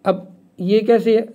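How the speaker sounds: ordinary talk, nothing marked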